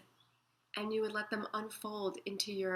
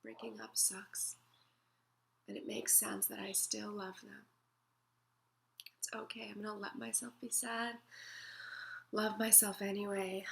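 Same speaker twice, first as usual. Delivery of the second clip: much softer, gentle whisper to yourself; hushed and tender; soothing self-talk; 8 dB quieter than usual. Shown as a demonstration. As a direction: comfort yourself through the sadness.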